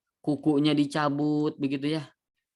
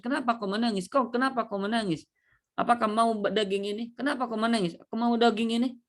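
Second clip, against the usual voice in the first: higher, insistent questioning; questioning onlookers; 7 semitones higher than usual. Acted. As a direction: press him on why he cries. Repeated questioning. (suara orang yang mendesak bertanya, lebih tinggi)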